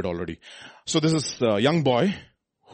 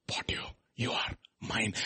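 intimate whisper; 10 dB quieter than usual. Acted. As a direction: whisper it as a tender claim. Jesus's words voiced intimately.

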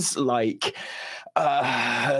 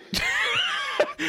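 sudden high whooping laugh; embarrassed laugh on return. Real, not acted. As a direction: burst out laughing at yourself. Nick returns from a call, laughing.

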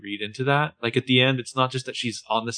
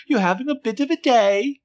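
much higher, louder mock British accent, teasing; 13 semitones higher, 5 dB louder than usual. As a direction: ham up a prim British phrase. Mocking the actor's British line.

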